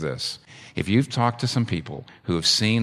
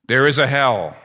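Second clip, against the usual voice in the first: louder, startled cry of realization; alarmed exclamation; 4 semitones higher, 8 dB louder than usual. Retold or imitated. Brothers after Lazarus returns, alarmed loud cry. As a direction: blurt it out in sudden alarm.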